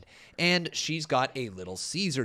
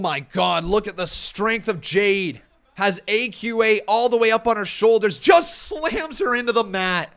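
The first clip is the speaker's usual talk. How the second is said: excited; 5 semitones higher, 5 dB louder than usual. Real, not acted. Live wrestling match commentary.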